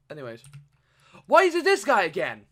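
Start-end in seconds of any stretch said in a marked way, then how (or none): 1.3-2.4 high, loud complaint; exasperated yell. Real, not acted. Gamer meets the same annoying character, exasperated yell.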